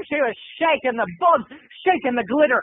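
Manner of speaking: normal talking voice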